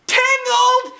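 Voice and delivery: shocked yell. A narrator's reading. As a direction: yell it in horror. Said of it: "I yelled".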